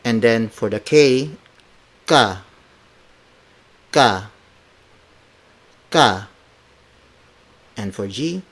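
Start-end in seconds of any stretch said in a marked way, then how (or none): 2.1-6.3 "ka" with no puff of air after K; unaspirated K. Shown as a demonstration. Teacher models the letter K, "ka" without aspiration. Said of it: "these consonants are unaspirated".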